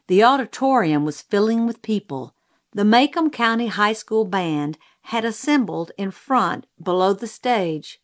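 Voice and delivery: usual speaking voice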